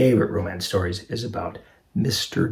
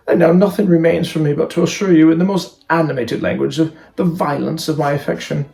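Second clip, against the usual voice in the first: earnest, slightly raised proposal voice; ardent silly suitor; 6 semitones higher, 8 dB louder than usual. Acted. Silly man proposes to an unwilling woman, earnestly ardent. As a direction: play a foolish man declaring his passion.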